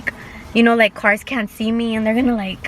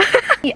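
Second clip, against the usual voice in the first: high laugh; 13 semitones higher than usual. Real, not acted. Laughs at own story.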